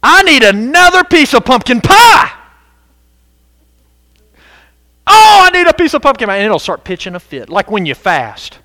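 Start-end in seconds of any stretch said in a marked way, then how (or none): 0.0-6.4 high, loud whining for pie; whiny craving self. Retold or imitated. Flesh begging its owner for pie, whining loud and high. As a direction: plead like a greedy child for pie. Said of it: "When yourself says".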